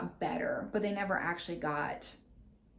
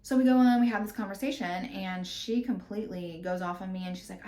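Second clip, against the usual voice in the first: ordinary talk, nothing marked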